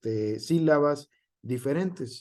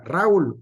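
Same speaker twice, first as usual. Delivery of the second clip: "Raúl" said RÁ-ul, loud on the a; acento en la A; 4 semitones higher, 7 dB louder than usual. Shown as a demonstration. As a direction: make "Raúl" a diphthong, stressing a. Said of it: "con el acento en la A". ("Raúl" en una sílaba, acento en la a)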